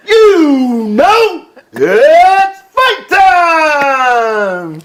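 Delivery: drawn-out ring announcer call, building to a huge finish; ring announcer intro. Shown as a demonstration. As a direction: hype the crowd like a ring announcer building to the main event. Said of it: "What's, what is it?".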